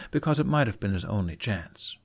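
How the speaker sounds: ordinary delivery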